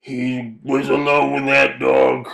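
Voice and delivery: lightly voiced old-timer warning. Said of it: "old timer".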